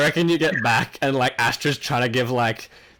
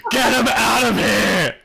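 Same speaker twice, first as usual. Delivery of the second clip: much higher, slow, louder yell; frantic yell; 11 semitones higher, 4 dB louder, slower than usual. Acted. Astra ordering the drunk out during CPR, yelling. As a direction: shout it out like a panicked friend. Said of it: "Astra's just like".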